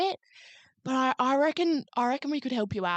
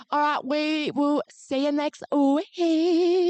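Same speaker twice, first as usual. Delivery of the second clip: slow, raised, louder farewell stretched out; sing-song, drawn-out podcast send-off; big sign-off; 4 semitones higher, 5 dB louder, much slower than usual. Real, not acted. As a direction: draw the goodbye out high and loud.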